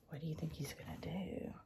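hushed suspense whisper. Real, not acted. Asks children in suspense.

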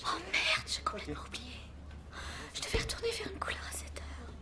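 panicked whisper. Acted. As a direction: breathe it in a hushed panic.

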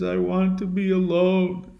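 soft, drawn echo; restrained echo of a rejection; Echo repeating. Retold or imitated. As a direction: hand the words back without the anger. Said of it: "Echo can only repeat what you've said".

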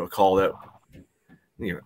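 usual speaking voice

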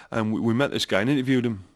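ordinary talk, nothing marked

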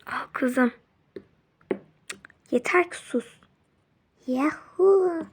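normal talking voice

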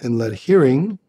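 a calm meditation teacher's guiding voice; guided meditation. Acted. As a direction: lead them gently into just hearing.

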